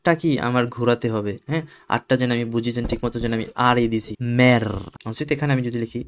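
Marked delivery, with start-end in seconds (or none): none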